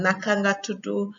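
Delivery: Senegalese Wolof, "tudu" with final "oo"; Senegalese accent. Shown as a demonstration. Teacher contrasting the two accents for learners, Senegalese accent. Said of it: "Senegalese accent and Gambian accent".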